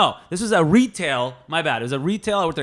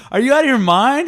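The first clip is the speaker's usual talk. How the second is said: high, loud mock outrage; the boss scolding a slacker; 10 semitones higher, 6 dB louder than usual.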